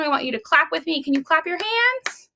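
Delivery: plain reading voice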